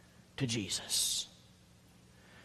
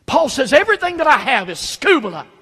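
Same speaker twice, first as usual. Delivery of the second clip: much higher and louder, fiery pulpit preaching; preaching at full force; 10 semitones higher, 12 dB louder than usual. Acted. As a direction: hammer it home to the congregation. Sermon climax, preaching at full force.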